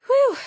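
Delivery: high, loud exhale of relief; loud relieved whew. Acted. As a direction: blow it out with relief. Relief after a tense moment.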